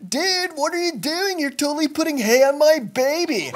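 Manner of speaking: high, put-on voice of an annoyed mother; lady's voice. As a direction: play the startled mom at the manger. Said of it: "this lady's like".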